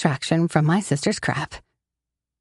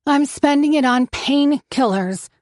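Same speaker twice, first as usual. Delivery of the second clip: "painkillers" leaned on hard, slow and high; stress on painkillers; 7 semitones higher than usual. A narrator's reading. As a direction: insist on the word "painkillers". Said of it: "she emphasizes the word".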